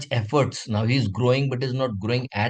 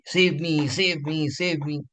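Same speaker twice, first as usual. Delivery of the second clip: mildly frightened, higher-pitched pleading, not shouted; hurried mock cries for rescue, raised pitch; fearful cry for help; 4 semitones higher than usual. Frightened cub at sight of a huge lion, acted fearful cries. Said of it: "he's so fearful that he starts shouting".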